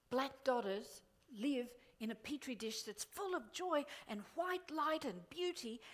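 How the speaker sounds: usual speaking voice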